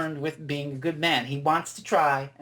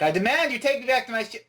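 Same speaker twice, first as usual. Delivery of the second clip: high-pitched, forceful mock demand; 7 semitones higher, 3 dB louder, faster than usual. Fan acting the gun-holder ordering the Doctor, demanding. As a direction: imitate her demanding, pitch raised. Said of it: "Not like that".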